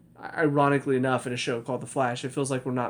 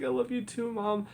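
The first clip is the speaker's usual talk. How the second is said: sweet daughter reply; 8 semitones higher than usual. Retold or imitated. Retelling the daughter answering her mom, lightly voiced sweet and higher. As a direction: lift it up like a sweet kid.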